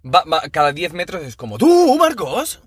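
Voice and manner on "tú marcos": high, slow, squeaky mock shout of a name; squeaked-out startled call; mock startled yelp. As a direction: yelp the name like a jumpy driver. Mocking friend startled by police.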